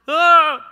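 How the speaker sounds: high, loud yelp of terror, acted; mock girlish scream. Preacher mimicking terrified disciples in the boat, girlish scream. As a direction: imitate a scared little girl's scream. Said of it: "they scream out like little girls".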